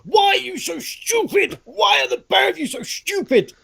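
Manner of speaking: high-pitched, strained outburst at the screen; exasperated yell. Acted. Viewer yelling at the monitor at two hapless gamers, exasperated. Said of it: "I just want to punch my monitor going".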